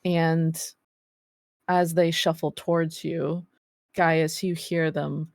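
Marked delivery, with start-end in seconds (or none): none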